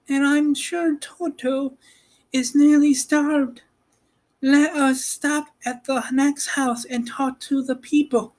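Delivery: put-on high young girl voice, curious; Dorothy's girl voice. Girl asking about Oz, high and curious.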